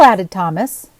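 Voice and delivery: plain reading voice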